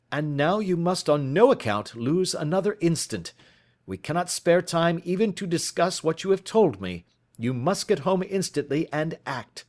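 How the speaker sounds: detective pressing for evidence; Holmes urgent. Acted. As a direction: urge him on, impatient. Holmes's dialogue voice.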